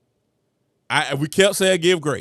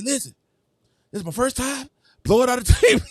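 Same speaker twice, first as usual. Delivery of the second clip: mock whisper to rival coach; 4 semitones higher than usual. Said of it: "whispered to Noah Quinn".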